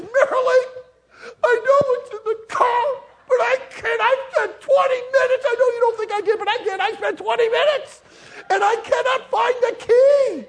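hammed-up high, loud bawling; crying. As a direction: wail through mock sobs to your wife. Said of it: "I start crying".

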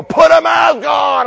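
high, loud, drawn-out scolding yell; mock yelling. Church member yelling at the unmasked, mock angry shout. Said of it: "literally yelling at other people".

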